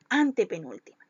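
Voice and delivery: usual speaking voice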